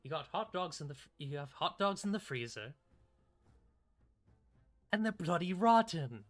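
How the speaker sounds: a hint of a British chef telling off a kitchen; mock British chef. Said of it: "He's like".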